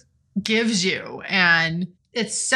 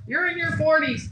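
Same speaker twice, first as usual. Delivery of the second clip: high, gushing mock disbelief; mocking gushing fan; 7 semitones higher than usual. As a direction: imitate an amazed commenter, pitched up. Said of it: "people, that comment, like".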